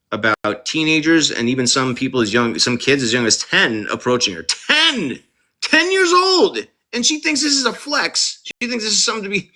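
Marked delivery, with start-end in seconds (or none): 4.4-6.6 high, shocked cry of disbelief; appalled, pitch leaping way up; outraged disbelief. Real, not acted. Outraged at kids approaching her. 6.9-9.5 indignant rant. Real, not acted. Outraged rant continues.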